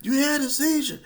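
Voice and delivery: high, loud urgent calm-down; paramedics calming. Retold or imitated. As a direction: shout soothingly at a thrashing patient. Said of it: "they like".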